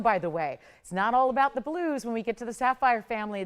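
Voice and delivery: ordinary talk, nothing marked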